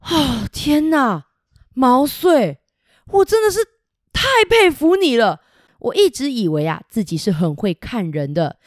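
delighted lord heaping praise; amazed admiration. Acted. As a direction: gush with wonder at his hero. Pingyuanjun praises Mao Sui.

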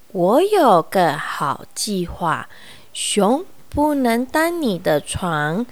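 slow, calm wise owl. Acted. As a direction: reassure like an old owl.

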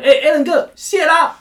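high, loud cheery thanks; grateful listener. Retold or imitated. Voicing a rich, grateful listener.

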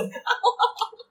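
边笑边重复，音调高很多; 笑着接话; laughing. Real, not acted. 重复鳄鱼死亡翻滚笑话. (边笑边说)

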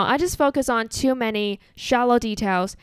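ordinary talk, nothing marked

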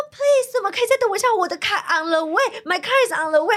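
very high, hammed-up begging; desperate pleading. Customer begging clerk to wait for her card, retold desperate plea.

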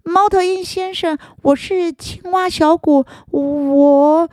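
high, shy little frog. Acted. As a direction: stammer thanks like a small frog.

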